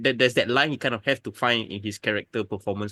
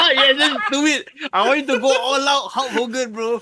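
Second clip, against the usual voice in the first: laughing; 13 semitones higher, 5 dB louder than usual. Real, not acted. Laughing while urging guest on.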